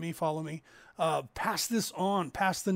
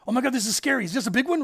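mock fright; 6 semitones higher, 6 dB louder, faster than usual. Girlfriend scared clinging to walls.

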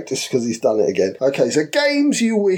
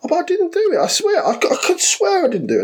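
high, laughing protest of innocence; pleading innocence; faster than usual. Retold or imitated. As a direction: swear you didn't do it, high and giggly.

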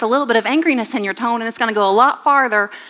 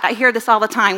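ordinary delivery

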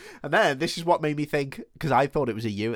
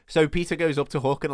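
normal talking voice

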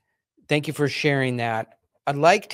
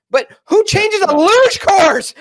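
much higher and louder, slow, half-shouting in disbelief; outraged disbelief; 6 dB louder than usual. Real, not acted. Host reacting to changing luge tracks, outraged shout. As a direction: blurt it out like you can't believe it, then swear.

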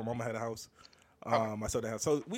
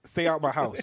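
softer, lower-key warning; toned-down mock rebuke; 3 semitones lower, 5 dB quieter than usual. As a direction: drop the volume on the warning. Same rebuke repeated, dropped back down.

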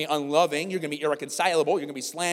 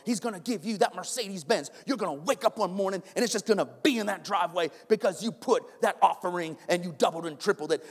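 higher, breathless hype-preacher parody; mocking prosperity preacher; 5 semitones higher than usual. Pastor parodying prosperity gospel for his church, hammed-up preacher. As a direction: sell the mansion dream, hammed up. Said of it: "I'm making fun of that".

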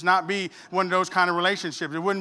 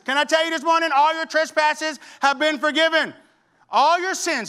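higher, louder, driving sermon cadence; full-force preaching; 9 semitones higher, 6 dB louder than usual. Acted. Pastor urging the congregation, fervent high preaching. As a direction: preach it hard and rising.

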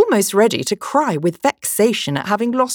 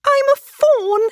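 very high, louder, joyful fawn voice; delighted cry; 5 dB louder, slower than usual. A narrator's reading. Fawn remembering what it is, crying out in delight. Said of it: "cried out in a voice of delight".

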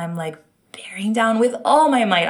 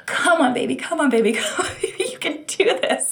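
inner urging; 9 semitones higher than usual. Retold or imitated. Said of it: "silently screaming to my body".

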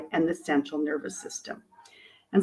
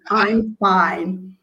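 mock complacent; 3 dB louder than usual. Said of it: "one of my mentors says".

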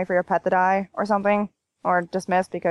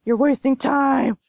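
higher, drawn-out mock raid leader; generic raid leader impersonation; 5 semitones higher, 3 dB louder, slower than usual. Raid leader chiding a slow player, mock impersonation. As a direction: draw it out like a nagging raid leader. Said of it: "it's just a generic impersonation".

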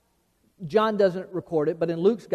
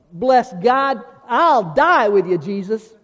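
bold vow; 3 semitones higher, 7 dB louder, slower than usual. Retold or imitated. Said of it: "Peter's the one who stood up and said".

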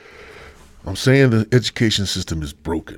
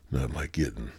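quiet queasy voice; sick, low and subdued; nauseous; 5 semitones lower, 5 dB quieter than usual. Real, not acted. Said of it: "getting sick on the air".

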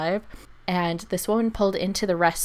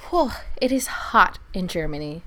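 breathy whisper. Real, not acted. Complaining about heat.

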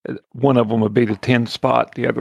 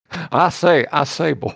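quick, higher cartoon southern rooster voice, Southern accent; Foghorn Leghorn; 5 semitones higher than usual. Player jokingly asks the game master about the southern accent, cartoon rooster voice in a Southern accent. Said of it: "like, Foghorn Leghorn".

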